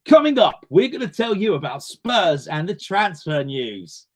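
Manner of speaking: announcer voice with a big high opening, then slow; hotline announcer. Retold or imitated. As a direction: ham up a phone-line radio host. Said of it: "And it was like".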